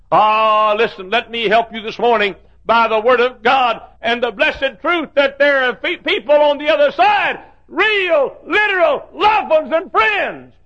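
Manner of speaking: high, loud preaching slowing to a shout; full-force preaching. Acted. Preacher assuring the congregation of loved ones waiting, fervent preaching. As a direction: drive home the promise of heaven.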